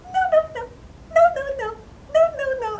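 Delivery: very high-pitched squeaky chant; little man's refusals. Acted. Little man rejects each guessed name, high squeaky voice. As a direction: sing out tiny high refusals. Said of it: "he kept saying".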